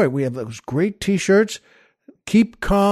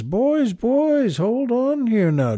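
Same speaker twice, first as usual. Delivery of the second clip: higher, drawn-out soothing priest; concerned priest; 5 semitones higher, 3 dB quieter, much slower than usual. Acted. Priest comes over to three crying altar boys, soothing. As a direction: gently hush upset children in a raised pitch. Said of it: "he comes over and says".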